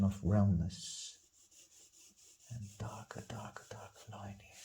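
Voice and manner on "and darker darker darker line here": whispered under his breath; a soft private whisper while drawing. Real, not acted. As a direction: murmur it to yourself as you work. Artist murmuring to himself while drawing.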